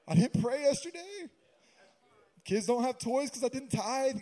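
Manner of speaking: high-pitched, hammed-up confession; mock guilty fretting. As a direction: fret like an overanxious rule-keeper. Mocks guilty legalist's worries.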